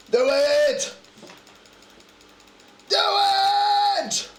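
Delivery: high yelled do it; shouting. Real, not acted. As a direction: scream it at yourself. Urging himself on.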